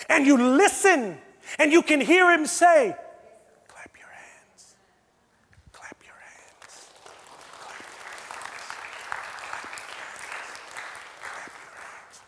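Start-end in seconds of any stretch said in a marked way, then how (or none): 3.7-12.2 slow, hushed whisper; still small voice. Acted. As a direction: whisper it like God's gentle voice. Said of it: "In that still small voice".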